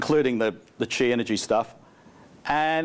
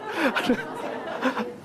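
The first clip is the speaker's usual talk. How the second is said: breathy high laugh, cut loose; 11 semitones higher, 3 dB quieter than usual. Real, not acted. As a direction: laugh out at the joke. Speaker laughs at audience joke.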